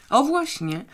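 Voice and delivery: high, relieved 'O właśnie'; clear relief. A narrator's reading. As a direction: let the relief out, glad to be understood. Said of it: "z wyraźną ulgą".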